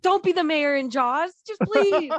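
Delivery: hammed-up plea to just leave; mock-earnest warning, voice climbing high; mock urgent warning. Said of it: "And she's like".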